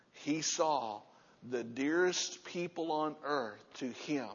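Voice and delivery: normal talking voice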